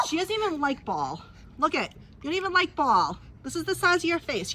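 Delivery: high, playful teasing at the dog; mock scolding a dog who won't play. Real, not acted. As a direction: tease her in a sing-song pet voice. Teasing the dog about Ball.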